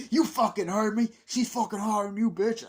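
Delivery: loud, high quarrelsome yelling; mock angry shout. Man yelling back at woman in film quarrel, mock furious. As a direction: imitate a foul-mouthed domestic shouting match. Acted foul-mouthed family quarrel.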